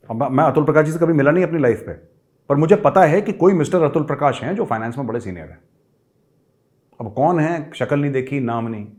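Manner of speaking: usual speaking voice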